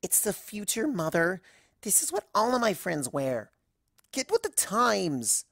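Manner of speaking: whiny mortified cry of Mother; a daughter cringing at her parent; embarrassed teen daughter. Acted. Daughter mortified by her mother, whiny cry.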